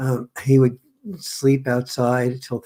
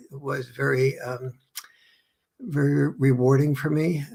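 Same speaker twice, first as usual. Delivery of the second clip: usual speaking voice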